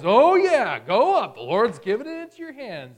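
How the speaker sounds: loud, high mock enthusiasm; heavy sarcasm. Prophet mockingly answering the king, heavily sarcastic. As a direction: overblown cheering that clearly means the opposite. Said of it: "there's heavy sarcasm there".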